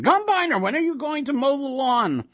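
high, loud nag at a husband; shrieky old-wife scolding; nagging wife. A narrator's reading. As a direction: holler for him to get moving. Wife nags husband, ignoring monster.

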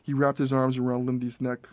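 ordinary delivery

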